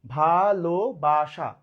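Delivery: ভালোবাসা very slowly as "bha - lo - ba - sha"; syllable by syllable. Shown as a demonstration. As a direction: stretch each of the four syllables of ভালোবাসা. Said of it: "চারবারে". (ভালোবাসা চার ভাগে, টেনে টেনে)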